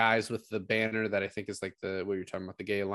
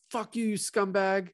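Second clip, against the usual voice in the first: high, raised jeer at a departing crook; voiced crowd heckle, pitched up; mock jeering crowd; 9 semitones higher than usual. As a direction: jeer like an angry mob. Angry crowd jeering departing robber.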